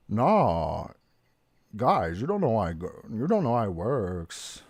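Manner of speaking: put-on low, laid-back SoCal accent; California voice. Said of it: "I don't do the California voice like, uh, Melton".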